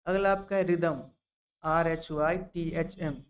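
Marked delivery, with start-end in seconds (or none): 1.6-3.2 letters spelled slowly one by one; slow spelling out. Shown as a demonstration. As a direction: dictate each letter slowly for the class. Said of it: "spelling ठीक से देख लीजिएगा". (धीरे धीरे अक्षर बोलकर spelling)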